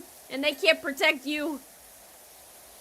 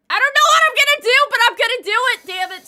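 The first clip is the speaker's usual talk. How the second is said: high, loud and fast, a hammed-up ranting threat; mock furious yell; 9 semitones higher, 14 dB louder, faster than usual. Scolding chat about an empty container, high fast mock yell. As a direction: blow up at chat in a mock rage. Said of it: "I'm just loudly explaining my stance".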